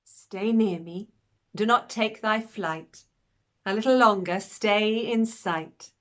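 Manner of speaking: slow, drawn-out verse with lingering words; poem recitation. Acted. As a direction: recite a beloved poem, savouring each line. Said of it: "That's Will's poem about us".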